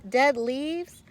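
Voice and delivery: plain reading voice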